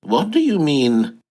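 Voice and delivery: ordinary talk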